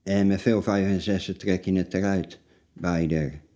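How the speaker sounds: normal talking voice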